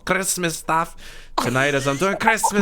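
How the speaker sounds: put-on German accent. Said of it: "talking like a German now".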